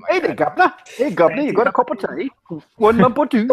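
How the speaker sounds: high, cheeky British caricature; mock UK accent. Greeting the governor over tea, exaggerated UK accent. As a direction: chirp it in a put-on British accent. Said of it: "do your U K accent".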